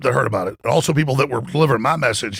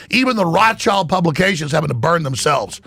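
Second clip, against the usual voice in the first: ordinary talk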